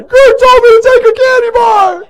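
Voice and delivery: high, wailing tattle through tears; crying kid. Retold or imitated. Tommy crying to his mom after getting caught, high and wailing. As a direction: blubber it out like a caught child.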